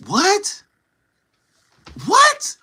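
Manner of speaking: very high, rising one-word question; exaggerated disbelief, pitch shooting up; incredulous reaction. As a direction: squeak it upward in mock disbelief. Acts out disbelief at her remark.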